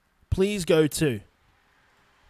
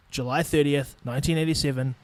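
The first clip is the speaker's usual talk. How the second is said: ordinary delivery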